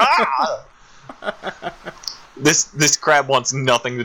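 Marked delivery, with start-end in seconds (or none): 0.0-0.6 high-pitched imitated "ah!"; mock yelp. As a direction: yelp like a startled little bird, playfully. Chick reacts to pinched beak.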